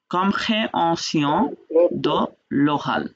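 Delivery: compréhension drawn out very slowly, then de, then l'oral; teacher's slow model of compréhension de l'oral; slow, word by word. Shown as a demonstration. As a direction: spell out compréhension de l'oral for learners, each word apart. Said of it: "কি বললাম?".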